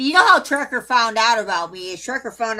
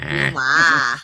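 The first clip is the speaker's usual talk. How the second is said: low, put-on Vietnamese accent; a lower imitation of a Vietnamese accent; mock Vietnamese accent; 8 semitones lower than usual.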